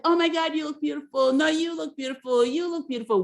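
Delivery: mock housewives gushing over each other; fake gushing compliments. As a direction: overdo the you look beautiful back and forth. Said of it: "a little fake".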